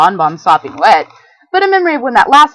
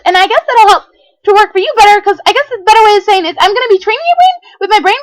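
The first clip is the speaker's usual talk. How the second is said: very high, loud, bouncy voice; bubbly party pony; 11 semitones higher, 6 dB louder than usual. Acted. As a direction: chirp it like a hyper party pony. Pinkie's bubbly voice.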